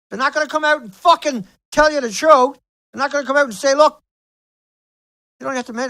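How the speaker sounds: real angry outburst; angry shouting. Real, not acted. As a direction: rage at the media. Angry rant about media.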